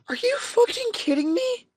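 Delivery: very high, thin squeak of disbelief; mock incredulous squeal. Reacting to a coworker's text asking to swap shifts, incredulous squeal.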